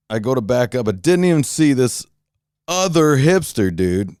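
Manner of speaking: usual speaking voice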